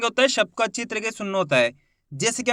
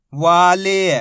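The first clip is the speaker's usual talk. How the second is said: whole word in one joined, drawn-out piece; teacher's blended model reading; syllables blended; 7 dB louder, much slower than usual. Shown as a demonstration. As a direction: join the sounds into one loud, clear word. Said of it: "आपस में मिला के पढ़ना है".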